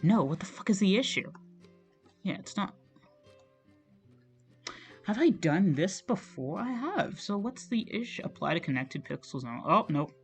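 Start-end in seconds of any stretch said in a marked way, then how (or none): none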